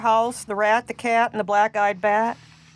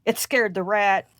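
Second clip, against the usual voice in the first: usual speaking voice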